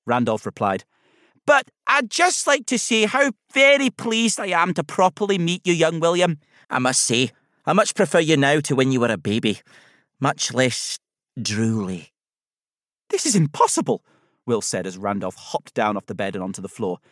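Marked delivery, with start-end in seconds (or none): 1.5-12.1 chatty Scottish character voice, easing down in pitch; Scottish accent. A narrator's reading. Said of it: "a voice with a soft Scottish accent". 13.1-14.1 put-on high-pitched astonished boy; incredulous, voice pitched up; amazed disbelief. A narrator's reading. Boy disbelieves living teddy bear.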